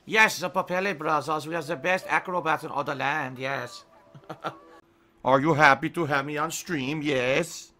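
hammed-up Scandinavian acrobat; playful Scandinavian showman voice; Scandinavian accent. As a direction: present the act in a mock Scandinavian accent. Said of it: "I turned to Scandinavian".

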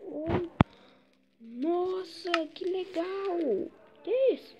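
high, wide-eyed wonder; amazed. Real, not acted. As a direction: marvel at every find. Discovering potion room.

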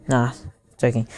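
usual speaking voice

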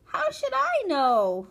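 high, loud, drawn-out whine; shrill aggrieved child; child's protest. A narrator's reading. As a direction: protest indignantly to mother.

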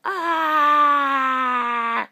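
long, loud wail; acted howl of a wounded dragon; dragon's cry. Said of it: "cried the dragon".

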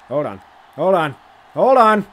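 from plain to a high, loud yell; rising panic turning into a shout; building excitement. Real, not acted. Tense last-second play building up.

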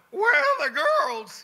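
high, loud, slow cry of disbelief; shocked, looking for the party; stunned disbelief. Retold or imitated. Expecting a wild scene, stunned.

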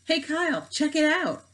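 high, loud call across the classroom; eager kid yelling for attention; excited shout. A narrator's reading. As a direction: shout it out to your friend. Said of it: "Miguel Fernandez shouted".

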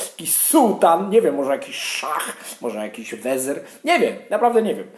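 ordinary delivery

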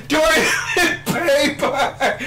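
high, drawn-out holler through laughter; mock desperate yell. Storyteller imitating Jake calling from the bathroom, high mock yell. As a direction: stretch it out as an imitated far-off call. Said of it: "Finally, we hear Jake say".

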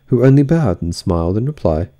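usual speaking voice

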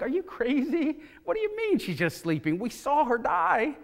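high-pitched, scoffing protest; mocking disbelief. Mourners mocking Jesus over the dead girl, scornful. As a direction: throw it back as an incredulous jeer. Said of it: "they must have almost mocked".

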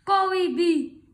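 loud shooing yell; loud flustered old lady; exasperated cry. Acted. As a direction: shout to chase it off. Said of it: "The little old lady cried".

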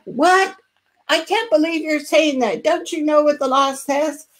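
mock indignant rant at the district; aggressive yelling. Shown as a demonstration. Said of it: "like, aggressively yelling at them".

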